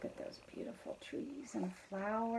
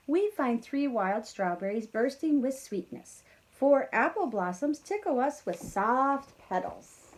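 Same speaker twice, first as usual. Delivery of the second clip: lively storybook read-aloud; reading to children; 3 semitones higher, 8 dB louder than usual. A narrator's reading. Teacher reading the bees' tale to kids, lively. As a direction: read the bees' lines brightly to the class.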